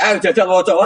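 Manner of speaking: high, loud and laughing; loud high laughing command; angel's challenge. Acted. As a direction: dare him to answer, cracking up. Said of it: "jare Mungkar Nakir".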